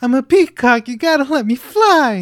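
exasperated protest, high and loud; hammed-up exasperated impression; Mark Wahlberg. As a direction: get worked up, pitch climbing, exasperated. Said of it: "like, ex-, exasperated".

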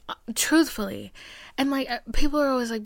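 ordinary talk, nothing marked

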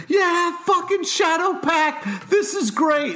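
high, slow mock-excited cheer; gleeful fan cheer. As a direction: gush like a thrilled fanboy. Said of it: "I was like".

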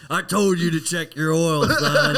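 loud, high imitation of a scolding grandpa; mock chewing-out. Grandpa scolding a kid over his oil, loud mock chewing-out. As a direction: bellow it like a grandpa telling someone off. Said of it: "chewed you out for oil".

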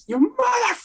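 high-pitched mock scream, cut off mid-curse; retold screaming. Director yelling at his effects artist over the phone, mock scream. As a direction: shriek the insult and break it off. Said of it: "screaming at him over the phone".

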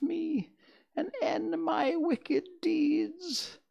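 very high witch's lament; wailing, despairing. A narrator's reading. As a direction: keep up a shrieky wail while melting away. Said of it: "despairing voice".

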